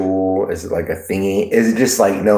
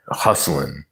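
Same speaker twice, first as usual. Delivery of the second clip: ordinary delivery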